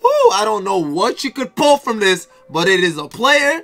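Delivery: high, loud, drawn-out hype shout; excited. Real, not acted. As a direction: shout each word with huge excitement. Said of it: "I'm excited".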